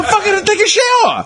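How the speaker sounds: high, exasperated laugh. Real, not acted. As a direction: blurt it out laughing. Laughing exasperation at smelly people.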